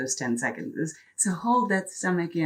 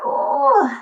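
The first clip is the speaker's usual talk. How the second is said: strained groan; 8 dB louder than usual. Real, not acted. Effort cry.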